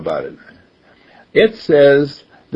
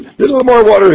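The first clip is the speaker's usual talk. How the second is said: high, loud call for materials; bricklayer calling out; 10 semitones higher, 8 dB louder, faster than usual. Acted. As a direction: call it out to the helper like a tradesman.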